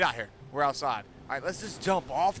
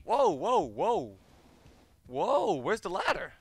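startled whoa; 7 semitones higher, 5 dB louder, slower than usual. Real, not acted. Suddenly in a strange place.

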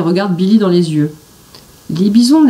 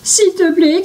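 much higher pleading cry; exhausted shout; 10 semitones higher than usual. A narrator's reading. Billy on the galloping bison, pleading exhausted. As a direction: beg at the end of his strength. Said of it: "crie Billy à bout de force".

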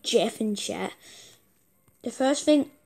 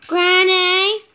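startled shout; 5 semitones higher, 6 dB louder than usual. Real, not acted. Spots Granny in horror game.

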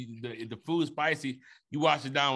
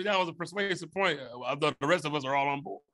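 ordinary talk

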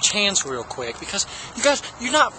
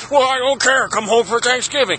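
higher, put-on dad voice; imitated dad voice; 5 semitones higher than usual. Dad insisting child come home, odd imitated voice. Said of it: "sound like the guy from Micro Center".

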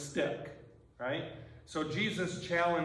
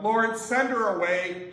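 annoyed disciples; 6 semitones higher, 6 dB louder than usual. Retold or imitated. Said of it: "So they say".

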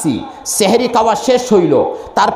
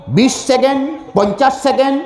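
usual speaking voice

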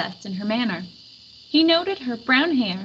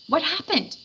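demanding; 6 semitones higher than usual. A narrator's reading. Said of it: "he demanded".

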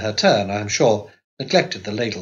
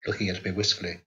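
ordinary delivery

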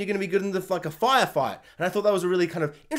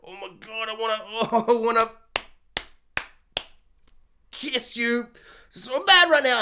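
giddy lovestruck confession; 4 semitones higher than usual. Retold or imitated. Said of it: "he's like".

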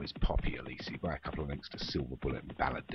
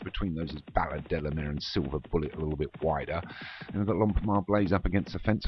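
ordinary talk

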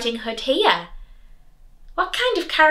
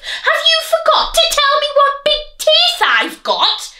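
high, loud indignant wolf cry; 5 dB louder than usual. Acted. Wolf demanding the girl mention his teeth, loud high cry. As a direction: shriek it, offended. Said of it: "cried Wolf".

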